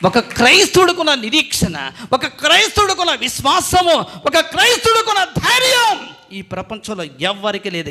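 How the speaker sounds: building bold declaration. Shown as a demonstration. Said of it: "గట్టిగా చెప్పడు".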